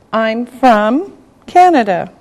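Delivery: ordinary delivery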